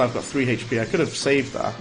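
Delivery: ordinary talk, nothing marked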